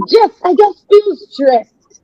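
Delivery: high, slow, helpless whine; mock stressed whine. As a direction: play someone who can't say why they're stressed. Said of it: "You're like".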